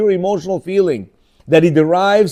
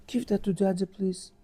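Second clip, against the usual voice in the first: much softer, coaxing plea; tender to a toddler; 2 semitones higher, 13 dB quieter than usual. Retold or imitated. Speaker asking a two-year-old to hand over cereal, soft coaxing. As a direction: coax the child gently.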